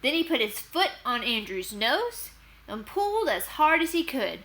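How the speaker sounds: plain reading voice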